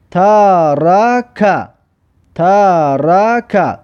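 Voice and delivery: ترک drawn out very slowly; slow demo pronunciation. Shown as a demonstration. Teacher sounds out ترک very slowly for pupils. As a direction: stretch ترک slowly for learners.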